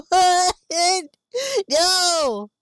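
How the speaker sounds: high, loud, laughing disbelief; incredulous laughing outburst. Real, not acted. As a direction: burst out in amused shock. Laughing, incredulous at friend's word.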